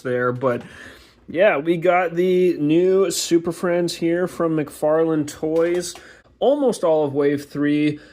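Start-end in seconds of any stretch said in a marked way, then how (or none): none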